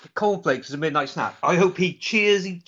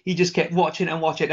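ordinary talk, nothing marked

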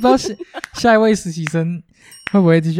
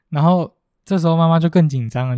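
ordinary delivery

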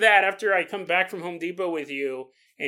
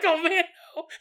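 high whiny protest; 12 semitones higher than usual. Retold or imitated.

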